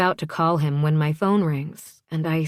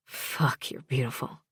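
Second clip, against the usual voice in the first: soft, breath-heavy murmur; said very softly under the breath; hushed admiration; 3 semitones lower, 7 dB quieter than usual. A narrator's reading. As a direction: murmur it, awestruck and drowsy. Sleepy man admires her on screen.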